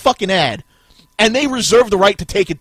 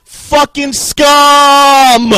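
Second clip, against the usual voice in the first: scum stretched out slowly in a full shout; screamed scum; 5 semitones higher, 12 dB louder, much slower than usual. Real, not acted. Raging at Robert, screaming scum even slower and louder. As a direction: roar the word scum, dragged long. Same word, louder repeat.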